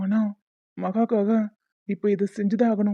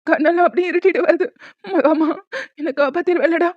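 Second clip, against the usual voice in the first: strained, higher voice fighting for breath; pained gasping; 5 semitones higher than usual. Acted. Father clutching his chest begs daughter to save him, gasping. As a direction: plead weakly through the chest pain.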